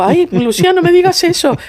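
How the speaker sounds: high-pitched mock complaint; retold pleading objection, pitch climbing high; playful protest. Younger friend objects to being called old-fashioned, mock protest. Retells own protest to Lucía's teasing.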